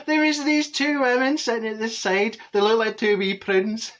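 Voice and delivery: words squeezed out through high laughter; laughing while telling. Real, not acted. Telling the story to Phil, breaking into high laughter. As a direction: laugh your way through remembering the prunes.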